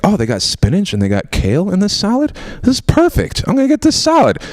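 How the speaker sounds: mock thrilled, high; excited delight. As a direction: gush over the salad. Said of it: "I was like".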